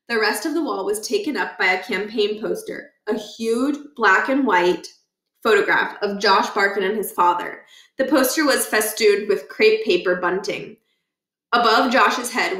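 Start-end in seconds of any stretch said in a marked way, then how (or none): none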